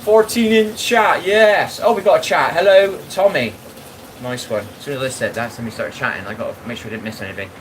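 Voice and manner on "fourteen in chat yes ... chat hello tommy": much higher and louder, slow and delighted; excited greeting. Real, not acted. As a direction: cheer as the first chatter arrives. Thrilled the chat started.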